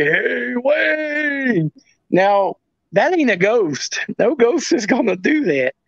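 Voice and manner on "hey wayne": high, long drawn-out call; imitated calling out. Imitating what the spirit box picked up, high drawn-out call. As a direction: mimic a distant voice calling a name, stretched high. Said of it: "you hear him clear as day say".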